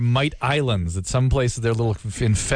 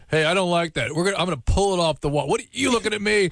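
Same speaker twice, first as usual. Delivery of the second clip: put-on rowdy drunk picking a fight, pitched up; drunk guy voice; 7 semitones higher than usual. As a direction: play the belligerent drunk. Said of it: "lots of drunk guys".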